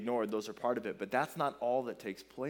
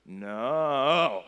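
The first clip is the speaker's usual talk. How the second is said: put-on louder, defiant little-kid "No."; defiant toddler; 8 dB louder than usual. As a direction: shout "No." back like a stubborn toddler. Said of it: "And he says".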